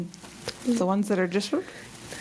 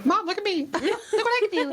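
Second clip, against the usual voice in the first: put-on kid voice; 11 semitones higher than usual. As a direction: chirp like a child showing off. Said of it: "they'll go".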